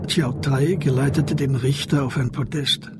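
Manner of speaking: normal talking voice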